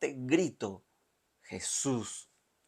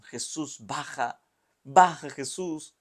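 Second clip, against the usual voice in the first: building from a call to a louder, higher cry; crowd's shout; 4 semitones higher, 7 dB louder, slower than usual. Acted. Crowds shout up at Jesus on the cross, mock shout. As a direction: yell at him to come down.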